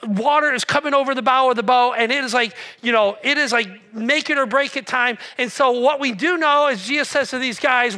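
ordinary talk

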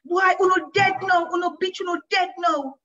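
high, loud rapid crowd commentary; excited patois shouting. A narrator's reading. Children shouting excitedly about the girl beating the boys, high and loud. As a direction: holler the fight play by play. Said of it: "the screams changed to".

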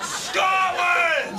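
long, high patriotic shout; drawn-out roar of defiance; defiant battle cry. Acted. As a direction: bellow it like a rallying cry.

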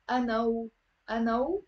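أنا and و run together as "anaw"; liaison. Shown as a demonstration. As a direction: link the w onto the end of أنا. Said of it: "link it with أنا".

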